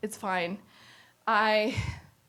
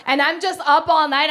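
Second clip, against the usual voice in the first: ordinary talk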